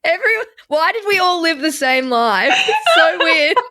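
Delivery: high, laughing cry; laughing disbelief. Real, not acted. Laughing with her co-host at shared memories. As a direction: laugh through the words.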